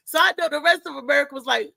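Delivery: usual speaking voice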